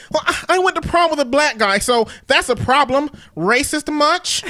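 put-on high-pitched teen-girl voice.

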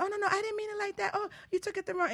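high, flustered backpedaling; apologetic salon lady. Retold or imitated. Salon lady apologizing to the offended customer, high and flustered. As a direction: hurry to smooth it over. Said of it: "And she said".

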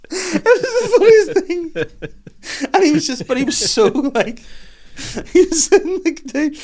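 high squeaky talk through laughter; real wheezing laughter breaking up the sentence; helpless laughter. Real, not acted. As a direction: struggle to get the words out, cracking up. Helpless laughter while telling the story.

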